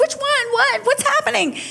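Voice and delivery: high, bewildered squeak; baffled and lost in the chaos; confused. Retold or imitated. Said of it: "And I'm like".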